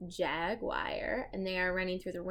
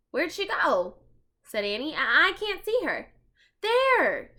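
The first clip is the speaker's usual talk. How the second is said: high, loud urgent calls; excited searching; 7 semitones higher, 7 dB louder than usual. Acted. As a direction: hunt for the mouse eagerly. Searching for mouse.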